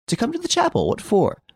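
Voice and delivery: very high incredulous echo; puzzled surprise. Acted. Master questions his chauffeur's orders, surprised. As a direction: question it back, pitch soaring.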